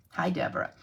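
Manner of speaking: normal talking voice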